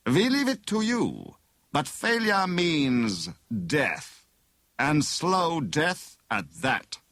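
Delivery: high start, then very slow threat; menacing. A narrator's reading. Bogus inspector threatens prisoner with death, slow menace. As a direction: linger on each deadly word. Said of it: "Dymchurch said menacingly".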